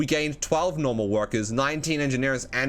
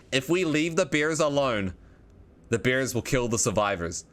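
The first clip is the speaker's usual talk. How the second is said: ordinary delivery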